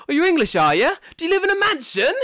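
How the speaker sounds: very high, loud caricature voice; exaggerated mocking questioner. How Jordan heard the question, loud high mocking caricature. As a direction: blare it as an outrageous taunt, squealing high. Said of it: "in my mind, I heard it as".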